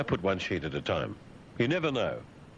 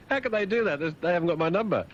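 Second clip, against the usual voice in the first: gleeful disbelief; 12 semitones higher than usual. Acted. Gleeful disbelief after laughing.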